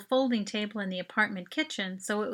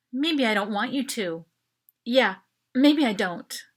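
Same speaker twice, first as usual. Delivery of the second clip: mild irritated retort, louder; tight and annoyed; 7 dB louder than usual. A narrator's reading. Mel rearing up to rebuff her friend, annoyed. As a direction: snap back, stung. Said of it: "her breathing tight and annoyed".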